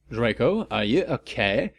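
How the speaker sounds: ordinary talk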